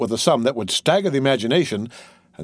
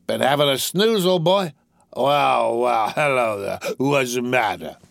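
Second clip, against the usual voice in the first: slow, slurry drawl to a pet; lazy, drawn-out tipsy chat; tipsy young master; slower than usual. Acted. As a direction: play a drunk young toff fussing over a dog.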